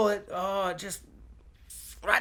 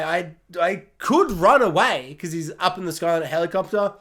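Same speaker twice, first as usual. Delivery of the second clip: ordinary delivery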